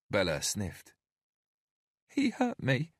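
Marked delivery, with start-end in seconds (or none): 2.1-2.9 put-on high, small frightened child; timid little girl pleading; scared little girl. A narrator's reading. Narrator voices Bella.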